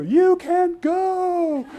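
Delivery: put-on very high, slow child's wail; pleading kids. Vendor's kids grabbing the buyer's legs, high drawn-out wail. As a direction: stretch it out like a child clinging to a leg. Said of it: "And they go".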